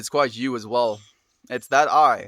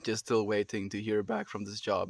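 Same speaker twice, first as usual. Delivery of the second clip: ordinary talk, nothing marked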